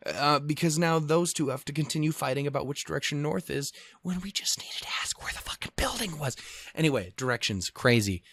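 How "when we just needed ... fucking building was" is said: hushed frustrated whisper; whispered aside. Real, not acted. Vlogger muttering his real frustration as a whisper. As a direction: whisper it like a confession.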